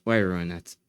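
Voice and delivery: usual speaking voice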